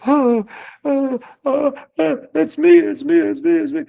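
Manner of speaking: high, breathless stammer of a starstruck fan; flustered squeal. Retold or imitated. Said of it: "and I'm like".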